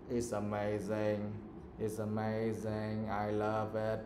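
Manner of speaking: "amazing" dragged out slow, low and monotone; negative tone, wrongly used. Shown as a demonstration. Teacher showing students what not to do, slow low monotone. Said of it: "You cannot say".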